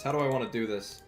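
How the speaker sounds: usual speaking voice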